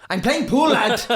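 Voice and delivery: high-pitched, put-out protest; mock indignant. Player refusing to leave pool for training, mock indignation.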